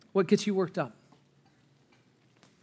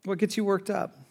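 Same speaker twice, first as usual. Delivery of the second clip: usual speaking voice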